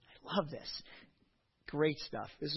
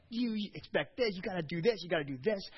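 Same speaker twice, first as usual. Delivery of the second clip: raised, sharper scolding barrage; mock yelling coach; 5 semitones higher than usual. Coach scolding his crying players, in mock anger. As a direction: lay into the girls like a coach. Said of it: "I am just laying into kids, like".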